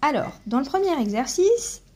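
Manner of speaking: ordinary talk, nothing marked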